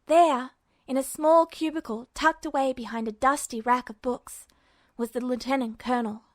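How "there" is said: ordinary delivery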